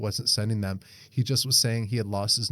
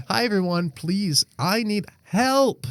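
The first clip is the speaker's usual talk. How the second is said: mock plea; 7 semitones higher, slower than usual. Mock pleading reading.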